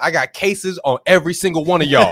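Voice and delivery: mock threat to the whole room; Denzel Washington. Co-host imagines an FDA enforcer, lightly imitated threat. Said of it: "is Denzel part of the F D A where he's like".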